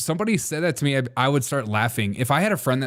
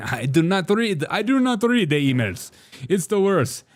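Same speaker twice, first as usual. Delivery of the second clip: higher, clipped mock Russian leader refusing; mock Putin; 7 semitones higher than usual. Putin answering Markle's emails, mock dismissive. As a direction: play a dismissive Putin brushing her off.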